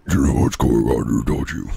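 mock evil Flowey voice; creepy demon voice. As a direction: drop into a sinister monster voice. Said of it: "That's what he sounds like".

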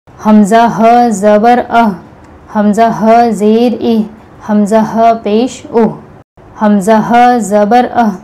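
slow breathy ह from the chest end of the throat, ह ज़बर अह; throaty h drill. Shown as a demonstration. Teacher drilling the ह sound with each vowel, slow. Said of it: "इस तरह से अदा होगा ये ह का मखरज".

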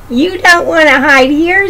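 high storybook duck voice. A narrator's reading. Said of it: "said the duck".

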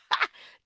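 short, loud laugh; real laugh at one's own slip; burst of laughter. Real, not acted. Preacher caught saying it wrong, laughing.

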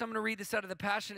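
ordinary talk, nothing marked

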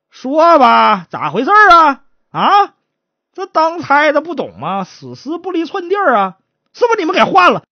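high, insistent interrogation; accusing magistrate. Acted. Magistrate accusing the guards of swapping the corpse, stern. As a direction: press him with loud questions.

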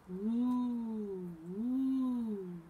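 long high hooted wind noise; drawn-out "ooh" of wind in trees; wind howl. A narrator's reading. Reader voices night wind in the trees, wind howl. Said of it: "blew the wind in the trees".

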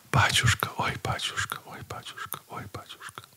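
whispered lament fading almost to nothing; a weeping old woman calling her priest softly; tearful whisper. Retold or imitated. Old bride weeping on the priest's shoulder, fading whispered lament.

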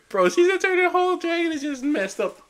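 very high, excited outburst; thrilled disbelief. Real, not acted. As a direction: gush in amazement, pitch shooting up. Thrilled recap of trailer moment.

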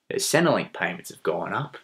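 usual speaking voice

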